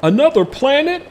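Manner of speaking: very high squeaky disbelief; squeaked. A narrator's reading. Officer hearing of aliens, squeaking in shock. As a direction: voice cracking up in shock. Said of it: "the officer's voice squeaked".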